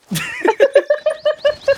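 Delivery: helpless high laughter; high laughing fit. Real, not acted. Laughing at chat.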